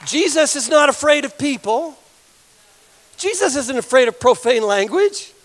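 high, emphatic preacher's exhortation; impassioned preaching. Real, not acted. Preacher defends Jesus among rough fishermen, impassioned preaching.

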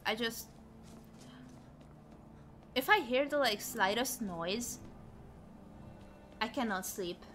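usual speaking voice

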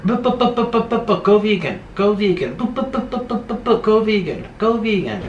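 high, stuttering, mechanical repeating; mock robotic broken-record chant. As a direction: spit it out like a stuck robot. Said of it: "I sound like a frickin' robot".

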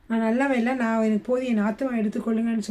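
usual speaking voice

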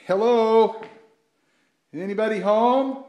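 loud, high call into the empty store; calling down a hallway. Real, not acted. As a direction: shout out to find someone in back.

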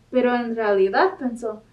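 plain reading voice

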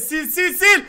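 loud, insistent repeated command; loud yelling. Real, not acted. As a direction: yell it over and over. Yelling at teammate to delete game.

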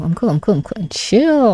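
ordinary talk, nothing marked

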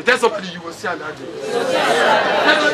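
ordinary talk, nothing marked

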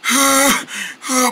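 heavy breathy sigh; dejected sigh. Acted. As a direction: sigh in defeat. Gives up over lost voice.

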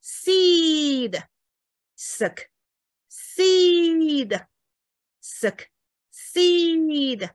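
long high seed against short suck; seed drawn out, suck brief, with gaps; syllable drill, long vowel. Shown as a demonstration. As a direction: stretch every seed, cut every suck short. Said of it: "你就知道它是长音".

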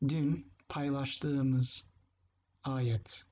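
Dün paylaştığımız ayet said very slowly, each syllable clear; teacher slowing down so students catch Dün paylaştığımız ayet; slow model phrase. Shown as a demonstration. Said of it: "because you say dün".

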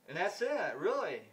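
ordinary delivery

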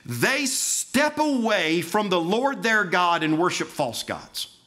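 high, loud and urgent pulpit delivery; full-force preaching. Acted. Preacher listing the church's sins to the congregation, fervent. Preacher's rising run of charges.

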